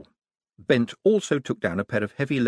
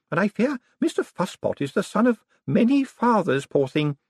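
put-on high, louder amused old lady voice; 11 semitones higher, 3 dB louder than usual. A narrator's reading. Old lady explaining the dog's breed, high and amused. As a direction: tell the tale with relish. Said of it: "Missus Lavish laughed".

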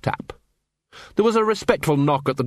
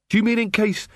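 higher, sharp and tart; 8 semitones higher than usual. A narrator's reading. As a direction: cut him down tartly. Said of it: "said Angua tartly".

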